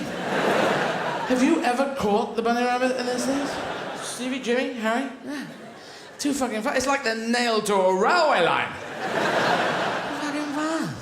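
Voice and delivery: high-pitched exasperated disbelief; hammed-up baffled loser; incredulous greyhound. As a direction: complain to your fellow racers in high amazement. Greyhounds complaining to each other after race.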